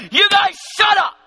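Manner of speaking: loud, high imagined outburst; yelling at mourners. Acted. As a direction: shout them down, half-playful, acting it out. Jairus voiced yelling at mourners.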